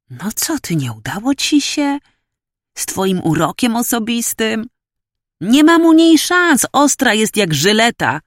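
high-pitched, incredulous lad; teasing young student. Acted. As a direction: rib your mate, voice climbing.

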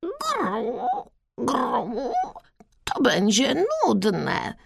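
soft cooing, then slow bored complaint; unimpressed bird voice; grumbling pigeon. A narrator's reading. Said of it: "skrzywił się Gienek".

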